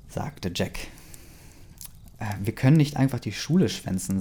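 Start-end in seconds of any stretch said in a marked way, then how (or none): none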